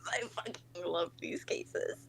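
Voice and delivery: soft, whispery confession of love; low-volume, heartfelt gush; hushed adoration. Real, not acted. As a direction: whisper it like a smitten fan. Hushed gush after the squealing.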